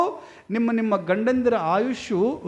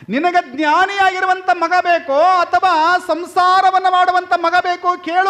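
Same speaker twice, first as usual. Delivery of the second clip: high, ringing voice of a guru; loud proclamation; 9 semitones higher, 8 dB louder than usual. Acted. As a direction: call out reassurance to the couple like a holy man. Guru repeats the offer loudly.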